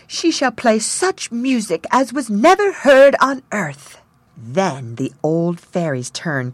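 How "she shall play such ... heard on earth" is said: high, loud dreamy fairy. A narrator's reading. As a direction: lift it up high and sweet. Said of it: "The sixth said".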